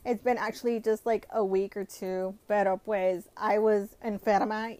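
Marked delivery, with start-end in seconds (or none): none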